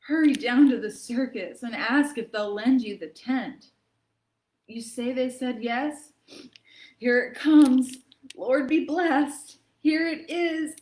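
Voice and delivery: hammed-up reading of a sniffly poem; milk every sneeze for the kids; dramatic poem reading. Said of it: "lends itself to a pretty dramatic reading".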